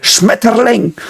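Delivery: high, slow mock-harsh "Schmetterling"; Hitler. Showing the speech mode Hitler used, mock-harsh "Schmetterling". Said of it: "the speech mode Hitler used".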